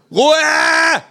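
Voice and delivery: full high-pitched yell; screamed. A narrator's reading. Migraine sufferer to noisy kangaroo, screamed. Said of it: "schrei ich".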